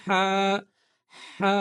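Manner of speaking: হা with air pushed out of the mouth; breathy ha. Shown as a demonstration. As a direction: let the breath rush out on হা. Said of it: "মুখের ভেতর থেকে বাতাস বের হয়ে যাবে".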